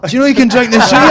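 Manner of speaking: high, loud, fast mock Glasgow accent, laughing; excited Glaswegian mimic. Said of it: "you'll just hear them going like".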